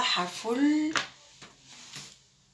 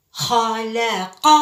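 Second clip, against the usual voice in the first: slow syllables; much slower than usual. Shown as a demonstration. Teacher drilling خلق slowly with the class. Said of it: "teacher models reading slowly".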